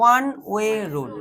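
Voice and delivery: very slow, each word stretched; slow dictation of a phrase. Shown as a demonstration. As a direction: spell it out slowly for copying. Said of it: "teacher dictating slowly".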